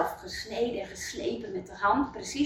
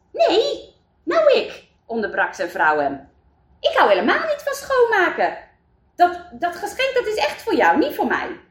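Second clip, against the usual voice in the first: much higher and louder, insistent; protesting wife; 8 semitones higher, 4 dB louder than usual. Acted. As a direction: cut him off, refusing the gift. Mayor's wife interrupts him. (veel hoger en luider, protesterende vrouw)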